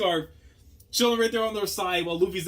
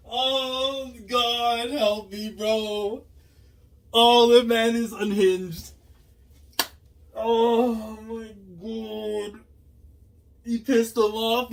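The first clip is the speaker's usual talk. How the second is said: high, loud stunned cries; frantic exclaiming; 8 semitones higher, 6 dB louder, much slower than usual. Real, not acted. Watching the villain go wild, high frantic exclaiming. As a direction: gasp it out, overwhelmed.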